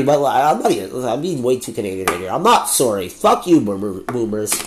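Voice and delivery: usual speaking voice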